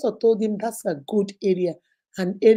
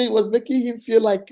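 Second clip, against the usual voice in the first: ordinary talk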